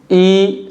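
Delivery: ई held long and slow; ई stretched for the long vowel; long ee sound. Shown as a demonstration. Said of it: "ई को बोल रहा हूँ तो मुझे ज़्यादा समय लग रहा है".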